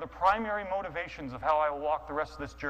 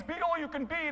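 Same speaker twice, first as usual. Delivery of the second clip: Marines slogan; 11 semitones higher than usual. Retold or imitated. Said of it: "It sounds like the Marines".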